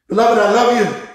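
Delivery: normal talking voice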